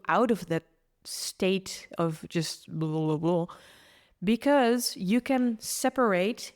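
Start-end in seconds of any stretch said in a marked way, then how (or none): none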